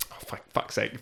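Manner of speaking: whispered under breath; muttered curse. Real, not acted. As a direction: mutter it to yourself. Muttered self-correction.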